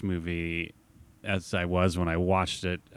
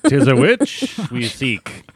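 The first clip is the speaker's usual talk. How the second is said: put-on slow, higher, louder old-timey declaim; hammy stage actor; 5 semitones higher, 6 dB louder, slower than usual. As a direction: proclaim like a medieval villager.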